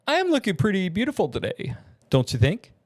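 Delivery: quick, high, flamboyant preening; Summoner James. Acted. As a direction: boast brightly with a confident lilt.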